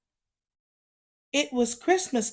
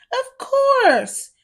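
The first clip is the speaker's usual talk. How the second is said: high, cheerful elf voice; elves; 13 semitones higher, 4 dB louder than usual. Acted. Elves welcoming Oliver's help, cheerful. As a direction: answer brightly as a crowd of elves. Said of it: "the elves replied".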